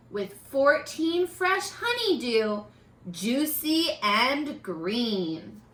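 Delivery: normal talking voice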